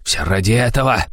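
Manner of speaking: high, raging hysterical tirade; hysterical rant. A narrator's reading. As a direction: rave at him furiously. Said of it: "как понесло".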